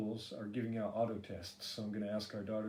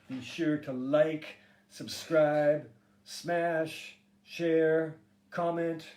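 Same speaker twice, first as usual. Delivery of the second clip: youtuber sign-off; 5 semitones higher, 8 dB louder, much slower than usual. Acted. Youtuber sign-off call to viewers.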